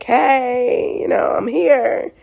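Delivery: put-on high, whiny baby voice with a cry in it. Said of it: "and he's like".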